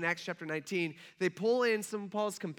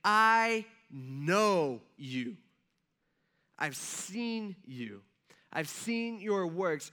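slow quote; much slower than usual. Retold or imitated. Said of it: "He's saying".

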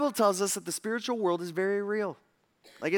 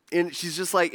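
ordinary talk, nothing marked

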